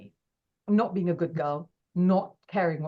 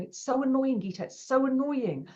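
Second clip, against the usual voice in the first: mildly cross friend; 6 semitones higher than usual. Retold or imitated. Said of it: "he was so cross about it".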